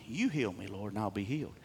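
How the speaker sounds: low, hushed matter-of-fact quote; quiet statement. Acted. Preacher restating the prophet's prayer as a statement, quiet certainty. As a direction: state it softly as settled. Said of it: "he's making a statement".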